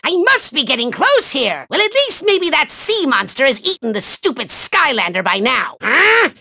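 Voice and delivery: ordinary delivery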